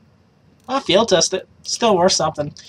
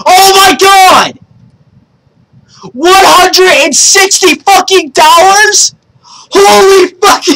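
real high-pitched, screaming excitement; loud, stretched-out shouts of shock and joy; yelling; 13 semitones higher, 6 dB louder than usual. Real, not acted. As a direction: yell in disbelief at a huge win. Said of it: "so sorry guys for yelling".

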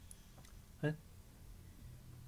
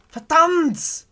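loud high curse of frustration; startled angry exclamation, loud and high; frustrated curse; 13 semitones higher, 7 dB louder than usual. Real, not acted. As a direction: spit it out in real annoyance. Caught by the animatronic.

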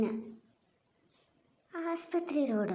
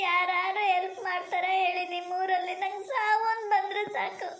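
tearful lament; 3 semitones higher than usual. Acted. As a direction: beg for death through tears. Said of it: "ಅಳ್ಬೇಡಿ". (ಅಳುತ್ತಾ ಹತಾಶೆಯಿಂದ ಗೋಳಾಟ)

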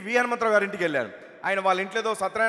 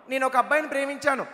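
lightly higher, shy daughter voice; a teen girl owning up to her dad, voiced a little higher; girlish confession; 4 semitones higher than usual. Teen daughter telling her father about a boy, shy and lightly higher.

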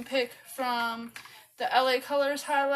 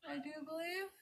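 ordinary talk

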